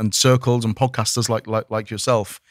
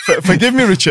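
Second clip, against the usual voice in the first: normal talking voice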